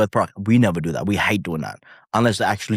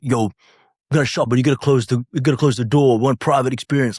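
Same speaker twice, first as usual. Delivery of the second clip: arrogant rapper; 4 semitones higher than usual. Retold or imitated. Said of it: "some arrogant rappers".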